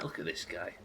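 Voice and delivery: ordinary talk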